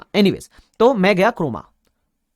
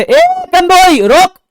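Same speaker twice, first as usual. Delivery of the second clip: very high, loud, drawn-out call to stop; shouting owner; 13 dB louder, much slower than usual. Retold or imitated. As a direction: yell after him from the back of the shop. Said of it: "Croma का मालिक चिल्लाया".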